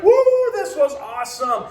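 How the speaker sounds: high excited whoop, pitch way up; thrilled kid. Retold or imitated. A kid just off the coaster, whooping high and excited. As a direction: cheer like a kid just off the ride. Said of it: "he's like".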